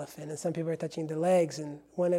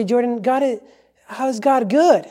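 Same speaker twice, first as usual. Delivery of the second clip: louder, higher voiced objection; challenging objection; 7 semitones higher, 12 dB louder than usual. Doubting listener challenging the preacher about God's goodness, challenging objection.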